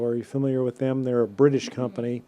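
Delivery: ordinary talk, nothing marked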